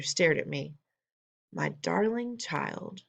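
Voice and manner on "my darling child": slow, soft and wondering; slowly. Acted. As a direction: savour each word in amazement. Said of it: "she said slowly".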